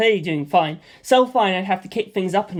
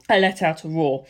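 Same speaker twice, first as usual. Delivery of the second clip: usual speaking voice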